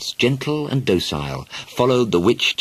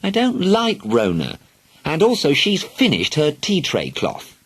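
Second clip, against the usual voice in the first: put-on whiny child; 8 semitones higher than usual. Sulky child continues complaining.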